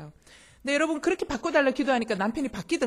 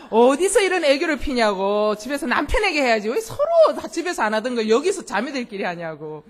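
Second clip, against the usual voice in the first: mock scolding; 3 semitones higher, 11 dB louder than usual. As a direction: scold the women in comic exasperation. Retold scolding of sisters acting cute.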